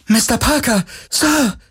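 very high, joyful call; high-pitched gleeful shout from afar; childlike joy. A narrator's reading. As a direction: call out with almost childish delight. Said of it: "rief die Terranerin ... mit fast kindlicher Freude".

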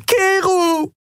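very high, drawn-out cry; tearful scream. A narrator's reading. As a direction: scream the name in despair. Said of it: "während heiße Tränen über ihr Gesicht liefen". (verzweifelter, hoher Schrei)

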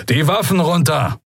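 high-pitched firm command; commander's order. A narrator's reading. Young commander orders trapped pirates to disarm, firm high command. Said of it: "befahl ein Weißmantel".

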